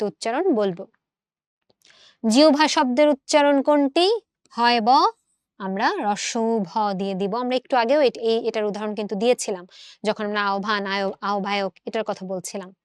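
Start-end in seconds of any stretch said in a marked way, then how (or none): none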